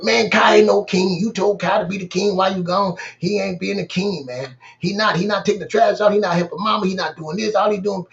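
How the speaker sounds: put-on a little brother telling on the older one; tattling kid. Son complaining about his brother.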